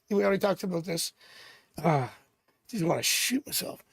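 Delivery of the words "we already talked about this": ordinary talk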